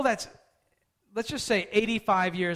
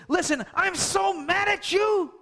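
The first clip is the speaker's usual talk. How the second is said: high, loud mock outburst at her husband; jealous anger; 9 semitones higher, 5 dB louder than usual. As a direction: rage at him like a jealous wife. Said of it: "goes to Abraham and says".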